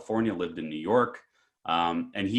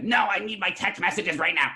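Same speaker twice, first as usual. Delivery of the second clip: high mock-frantic demand; imitating freaked-out customer; 9 semitones higher than usual. Old customer refusing the clerk's calm question, mock freak-out. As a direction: insist in the old man's worked-up voice. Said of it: "The guy's freaking out".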